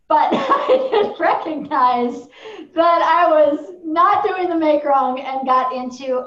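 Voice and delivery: loud, high, laughing admission; real laughter bursting into the words. Real, not acted. Laughs through her own admission.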